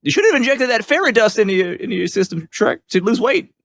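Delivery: high-pitched cartoon sidekick; high, joking animal-sidekick voice; Donkey from Shrek. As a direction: scold an ogre buddy in a high voice. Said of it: "Donkey will make, like, a joke".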